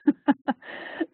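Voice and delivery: short, amused laugh. Real, not acted. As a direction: laugh lightly at your own rambling example. Apologizes jokingly to live captioner.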